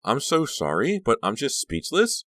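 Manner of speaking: put-on high, awestruck girlish gush; overwhelmed, pitch climbing; exclaiming. A narrator's reading. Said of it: "Honey exclaimed".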